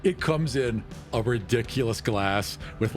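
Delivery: usual speaking voice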